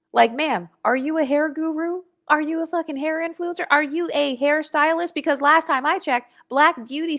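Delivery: high-pitched, sassy challenge to a ma'am; mock incredulous questioning. Creator challenging the woman who critiques her hair, incredulous.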